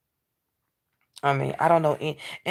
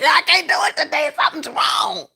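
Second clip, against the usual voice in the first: high, loud whiny wail; mock whining cry; 11 semitones higher, 8 dB louder than usual. Retelling what he said in class, loud whiny wail. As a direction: wail it like a tired kid giving up. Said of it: "I'm like".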